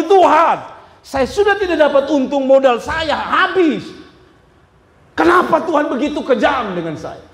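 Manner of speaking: loud, high accusing cry; angry complaint. Acted. Seller accusing God after missing the bus, angry shout. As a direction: rail against God for being cruel. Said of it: "Dia marah lagi".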